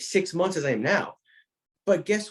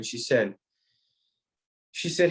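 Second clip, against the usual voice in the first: usual speaking voice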